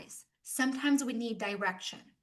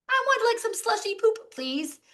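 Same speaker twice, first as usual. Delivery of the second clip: high, louder silly voice of a tourist ordering; mock tourist order; 11 semitones higher, 6 dB louder than usual. Speaker mimicking an American tourist ordering, high and loud. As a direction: ham up a polite customer ordering.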